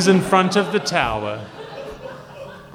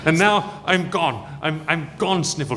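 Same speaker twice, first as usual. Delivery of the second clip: higher indignant, then fading; indignant villain; 4 semitones higher than usual. Acted. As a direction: huff and vanish. Villain fleeing.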